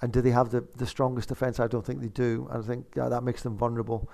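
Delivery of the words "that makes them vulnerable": usual speaking voice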